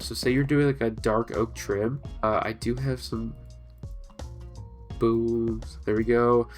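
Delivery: ordinary talk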